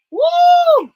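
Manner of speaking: high, loud woo of excitement; joyful whoop. Real, not acted. Host whooping as she offers free books. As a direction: whoop it out, thrilled. Excited after announcing book giveaway.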